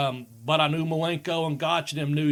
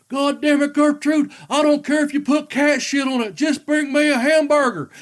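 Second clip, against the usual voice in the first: much higher, loud furious yell, laughing at the end; angry yell; 10 semitones higher, 7 dB louder than usual. Retold or imitated. Grandfather yelling at his wife over mayonnaise, furious. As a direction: bellow it like a fed-up drunk grandfather. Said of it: "And he'd say".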